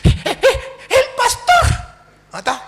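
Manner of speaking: panting, high-pitched panicked gasps; out of breath, trembling. Retold or imitated. Catechist running to the priest after the pastor cornered her, breathless and trembling. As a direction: blurt it between gasps, voice jumping high. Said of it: "llegó corriendo, temblando".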